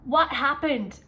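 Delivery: loud, sharp confronting bark; harsh demand. Shown as a demonstration. Teacher wrongly barking at an upset student. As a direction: demand an answer from the upset kid. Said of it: "if I went into the student like this".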